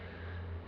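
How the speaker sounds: a long, tired breath out; weary sigh. Retold or imitated. Husband on the bed, sighing wearily beside his wife. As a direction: let the day's defeat out in one sigh. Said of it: "you sit down on the bed and you go".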